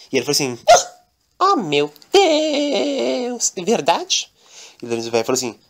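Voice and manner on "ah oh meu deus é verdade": very high, very slow gasp; hammed-up delighted maid receiving a gift; ecstatic maid. Daniela gets a gift.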